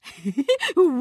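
loud, high laugh of glee; delighted belly laugh. A narrator's reading. Fat man hears the cure is near, delighted laughing. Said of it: "高兴地哈哈大笑".

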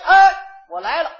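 loud, high, delighted "哎"; happy answer. Acted. Answering a friend's call, a loud happy yes. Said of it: "我还特别的高兴".

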